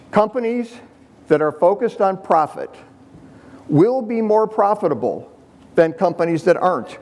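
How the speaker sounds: deliberately slow, spaced-out delivery with pauses; exaggerated slow explanation for progressives; said really slow. Shown as a demonstration. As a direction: spell it out slowly as if to a slow listener. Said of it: "now I'm gonna say this really slow".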